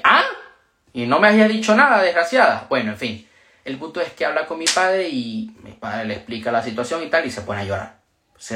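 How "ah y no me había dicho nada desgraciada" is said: higher, fast mock indignation; retold surprise. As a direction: play up the shock at being kept in the dark. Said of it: "y yo dije".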